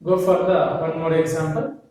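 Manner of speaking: ordinary talk, nothing marked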